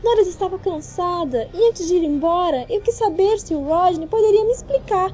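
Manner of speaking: muito aguda e alta, palavras lentas no fim; defesa furiosa. A narrator's reading. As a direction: grite que só conversaram. Noiva furiosa se defende. (fúria aguda e alta)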